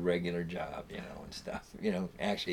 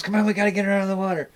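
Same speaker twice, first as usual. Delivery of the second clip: high, fast urgent shout; urgent call; 10 semitones higher, 5 dB louder, much faster than usual. Retold or imitated. Hap urging friends to rescue the drunk girl, loud and hurried. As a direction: hurry them to pull her out. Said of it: "Hap goes".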